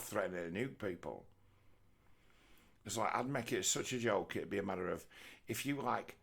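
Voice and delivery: ordinary talk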